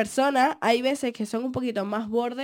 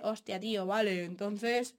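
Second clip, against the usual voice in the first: put-on Castilian Spanish accent; 5 semitones lower, 9 dB quieter, slower than usual. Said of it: "Cuando yo abro la boca y es sin ese".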